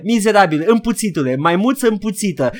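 high, loud mock insults; hammed-up berating of an overexcited friend; mock scolding. Imitating the co-host shooting down the hype, mock outrage. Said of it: "Și tu erai".

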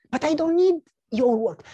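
high, loud objection; protesting refusal. Acted. Neighbor turns down the offer to work, protesting.

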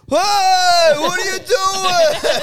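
high, loud, slow outraged yell; indignant shout. Retold or imitated. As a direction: call him out, shouting high and drawn out. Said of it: "he's like".

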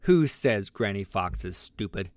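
ordinary delivery, nothing marked

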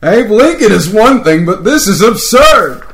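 McCoy losing patience, shouting in outrage; exasperated shout. Acted. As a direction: yell it in disbelief. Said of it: "McCoy's voice could be heard".

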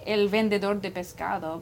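put-on says fishmonger in Spanish, Spanish accent. Asked for a Spanish-accent fishmonger, answers in Spanish accent. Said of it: "What if I say fishmonger with a Spanish accent?".

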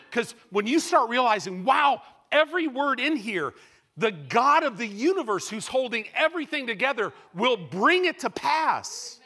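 preacher hitting the climax; rising excitement. Real, not acted. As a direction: lift it to a joyful peak.

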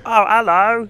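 loud, high exaggerated English accent; hammed-up tourist imitation of an English accent; mock English accent. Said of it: "come up and go to me and be like".

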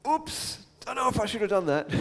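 high, quick sheepish Moses after the smash; sheepish oops. Retold or imitated. Moses regretting the smashed tablets, high sheepish oops. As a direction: squeak out an embarrassed oops. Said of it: "afterwards going".

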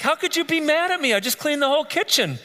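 high, wounded indignation; how could you, I just cleaned it; indignant protest. Retold or imitated. Husband protesting to his wife over the cleaned kitchen, indignant. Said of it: "I'm like".

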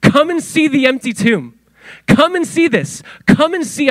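high, falling calls, with pauses between; lightly acted angel beckoning people in; angelic invitation. Angel invites the women to the empty tomb, beckoning. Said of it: "imagine an angelic being is like".

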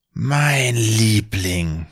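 very slow, tender murmur, lightly voiced; tender whisper. Wizard speaks lovingly to the stone basilisk, slow and tender. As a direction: coo it to a beloved pet. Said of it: "flüstert Kallmann liebevoll".

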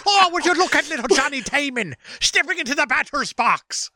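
high mock Irish commentator. Cohost joining the Irish announcer bit, mock Irish. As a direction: call the at-bat in hammy Irish. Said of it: "with an Irish accent".

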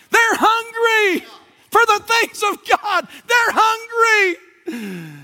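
high, impassioned pulpit delivery; full-force preaching. Real, not acted.

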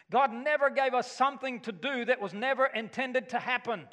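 usual speaking voice

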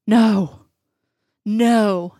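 drawn-out "no" with a touch of anguish; understated cry of anguish, held long. A narrator's reading. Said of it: "one word burst forth in a long, anguished cry".

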